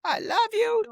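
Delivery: high, sappy mock sweetness; squeaky mock love voice; mocking ex-wife voice. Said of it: "I could show you again".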